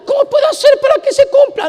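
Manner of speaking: high, loud mock fretting; mock anxious question. Preacher mocking a believer straining to fulfil prophecy. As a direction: ham up a worried striver.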